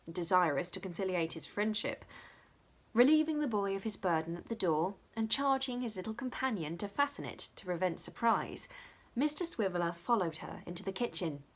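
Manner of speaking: ordinary delivery, nothing marked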